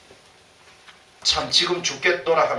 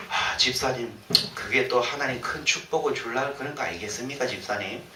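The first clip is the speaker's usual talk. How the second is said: softer, gently consoling, fading away; soothing; 4 semitones lower, 7 dB quieter than usual. Pretend. Pastor consoling a complaining deacon, softly and kindly.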